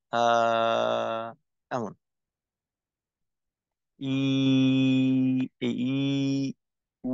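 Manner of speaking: আ, ই, উ each drawn out, breath unblocked; long madd vowels. Shown as a demonstration. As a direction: stretch each vowel freely. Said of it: "কোথাও বাধাগ্রস্ত না করে".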